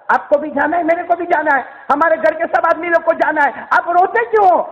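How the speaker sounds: high-pitched pleading argument; a preacher voicing a daughter's protest; insistent daughter. Daughter presses her weeping father.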